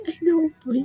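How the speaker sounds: high, pleading through tears; choked-up, high-pitched plea; tearful. Real, not acted. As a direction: retell it close to crying. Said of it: "I'm already crying".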